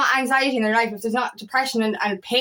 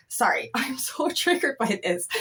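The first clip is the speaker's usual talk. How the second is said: slow, laughing whisper; sheepish whispered aside with a laugh; whispered apology; 5 dB quieter than usual. Real, not acted. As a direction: whisper it, a little embarrassed, half laughing. Apologises mid-rant.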